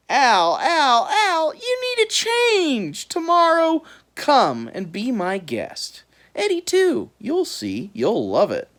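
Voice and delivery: high, loud coaxing bird; big bird voice. A narrator's reading. Large bird lures Al, high coaxing. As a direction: tempt him like a sly bird.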